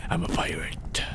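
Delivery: put-on soft accidental pirate accent; bad pirate accent. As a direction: let it sink into a hushed, silly pirate accent. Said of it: "I'm really sucking with accents today".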